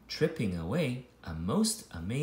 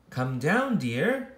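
ordinary delivery, nothing marked

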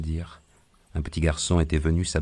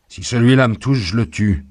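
high, loud cry of warning; threatening shout; 5 semitones higher, 8 dB louder than usual. Acted. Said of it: "cria l'homme". (cri de menace)

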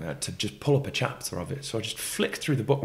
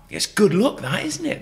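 put-on northern accent; 12 semitones higher, 6 dB louder than usual. Farmer voiced, northern.

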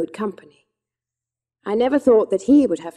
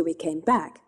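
usual speaking voice

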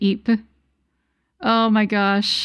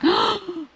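sharp high gasp of realisation; shocked gasp; 7 semitones higher, 4 dB quieter than usual. Real, not acted. Streamer realising she said Marine's name wrong, gasping. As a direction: gasp as the mistake sinks in. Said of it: "I'm so sorry".

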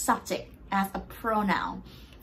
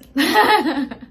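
loud laugh; 6 semitones higher, 8 dB louder than usual. Real, not acted. Laughs at forgetting German.

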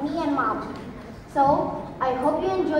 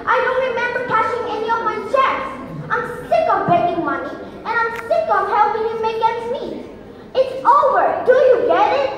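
raised, higher voice full of grievance; angry outburst; 5 semitones higher than usual. Acted. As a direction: let pent-up resentment pour out at Mom. Acted outburst at mother over money.

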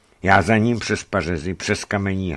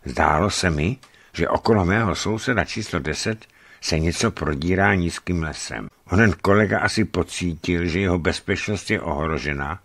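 plain reading voice

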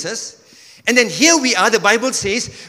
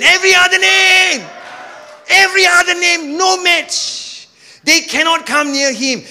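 preaching shout; 11 semitones higher, 4 dB louder than usual. Real, not acted. Preacher to church, loud and high. Pastor preaching about the name.

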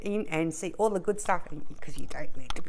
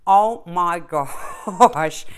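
laughing; 7 dB louder, much slower than usual. Real, not acted. Laughs at herself.

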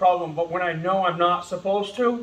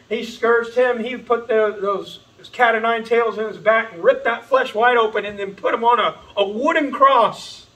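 higher, louder, impassioned preacher climax; full-force preaching; 5 semitones higher, 6 dB louder than usual. Real, not acted. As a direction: drive it home to the crowd.

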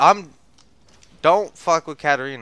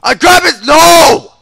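loud, high cry of dismay; panicked shout; 11 semitones higher, 8 dB louder, slower than usual. Real, not acted. Gamer as the grab fails, panicked shout.

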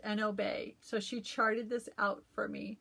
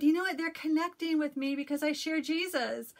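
excited telling; 5 semitones higher, 5 dB louder than usual. Retold or imitated. Telling husband her joy.

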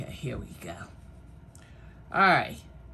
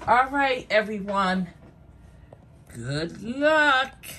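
upbeat announcement; 4 semitones higher, 3 dB louder than usual. Real, not acted. Opening the giveaway wheel spin.

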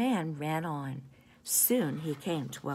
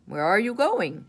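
loud shouted bear question; bear shouting; 4 semitones higher, 7 dB louder than usual. A narrator's reading. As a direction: call out like a big bear. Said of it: "shouted the bear".

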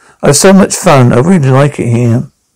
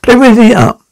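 excited call; 8 semitones higher, much faster than usual. Acted. Teacher rallying kids to dance.

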